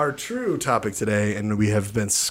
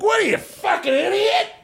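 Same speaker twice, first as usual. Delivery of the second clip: incredulous yell. Retold or imitated. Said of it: "And I was like".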